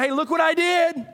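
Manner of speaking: loud, high show-off voice; cocky boast in a loud high voice; boastful quote. Acted. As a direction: crow about yourself like a braggart. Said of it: "you're not going".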